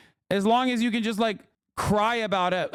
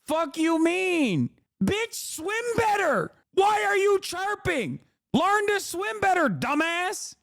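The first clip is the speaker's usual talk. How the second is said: high, heated, jeering shouts; furious mocking rant at sore losers; angry high rant; 10 semitones higher than usual. Real, not acted. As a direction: tear into them, pitch up and yelling. Ranting at the clip's athletes.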